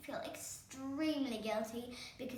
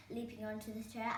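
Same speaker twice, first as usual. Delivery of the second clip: usual speaking voice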